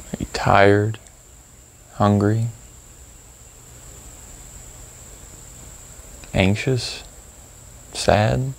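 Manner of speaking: calm, spacious phrases with long pauses; gently prompt, leaving room to notice each feeling; guided meditation. Acted. Guide leading listeners to reflect on procrastination, calm meditation delivery.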